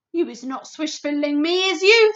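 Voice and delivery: loud, rising suspicious challenge; suspicious giant. A narrator's reading. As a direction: threaten while doubting. Bloodbottler, suspicious.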